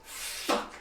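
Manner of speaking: usual speaking voice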